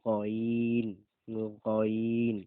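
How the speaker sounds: গইন loud, rasped from the throat; teacher shows the guttural গইন; throaty ghain. Shown as a demonstration. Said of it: "তারপরে দেখেন গইন".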